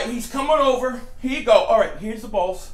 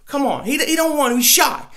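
ordinary talk, nothing marked

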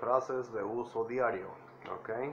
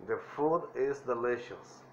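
"The food is delicious" slowly, stress on "food"; drawn out for learners; slow teacher demo; slow model phrase. Shown as a demonstration. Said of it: "traten de imitar mi voz".

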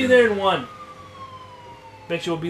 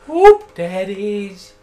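drawn-out playful call; 2 semitones higher, 8 dB louder, much slower than usual. Acted. Riffing on the word.

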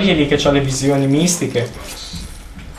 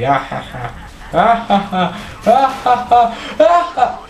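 mocking imitated laugh; 5 semitones higher than usual. Player mimicking a laughing game character, mock laughter. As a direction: fake laugh climbing steadily higher. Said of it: "cosa ride quello lì?".

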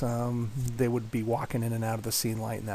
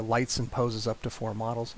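ordinary talk, nothing marked

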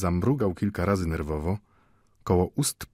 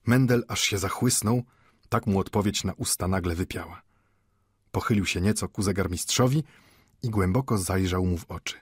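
ordinary delivery, nothing marked

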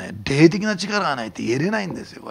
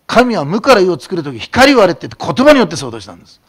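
full-force preaching; 4 semitones higher, 10 dB louder than usual. Acted. Pastor thundering at his congregation, loud preaching. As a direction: proclaim it to the whole hall.

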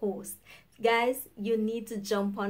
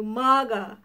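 mock taunt; 8 dB louder than usual. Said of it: "they will call you".

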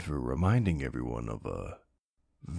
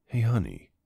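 cheerful greeting; 6 semitones higher, 6 dB louder than usual. Acted. Greets imagined girlfriend.

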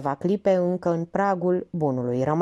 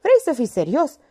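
higher, louder exasperated question; captain losing patience with his lieutenant, exasperated; annoyed snap; 8 semitones higher, 5 dB louder than usual. A narrator's reading. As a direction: snap at him to be serious. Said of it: "Capitanul se enervă".

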